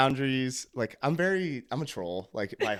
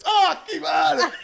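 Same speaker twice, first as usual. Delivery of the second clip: much higher, slow, stretched and louder; excited call-out; 7 dB louder, much slower than usual. Retold or imitated. Danilo greeting Kevon across the hall, excited. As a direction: call his name with joy.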